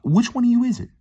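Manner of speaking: very high, loud mock demand; mock confrontation. Confronting whoever bothers the Rasta man, high-pitched mock outrage. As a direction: call them out in a comically high voice.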